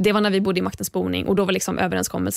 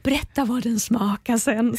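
impressed Micke; 2 semitones higher, slower than usual. Retold or imitated. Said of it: "han bara".

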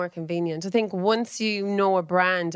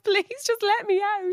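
high, pleading plea; mock pleading. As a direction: beg to be let out, half laughing. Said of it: "I was like".

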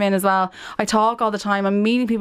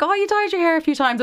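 lightly raised motherly chirp; high chirpy mum voice; 9 semitones higher than usual. Retold or imitated. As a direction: quote mum brightly and higher. Mum quoted.

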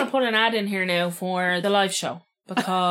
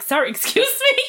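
laughing mock disbelief; 12 semitones higher than usual. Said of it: "I was like".

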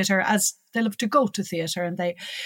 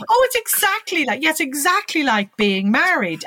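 eager agreement; 7 semitones higher, 3 dB louder than usual. Retold or imitated. Retold eager reply to mum.

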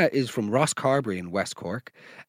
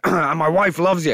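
ordinary talk

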